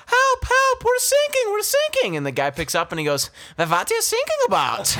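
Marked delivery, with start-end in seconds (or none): 0.1-2.2 high squeaky Elmo cry; panicked Elmo calling for help. Retold or imitated. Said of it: "Why is Elmo on the phone?". 3.5-4.9 put-on high German accent, laughing; German coast guard answering.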